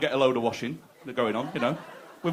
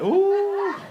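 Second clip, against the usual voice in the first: mock wince; 4 dB louder than usual. Real, not acted. Reacting to own dark joke.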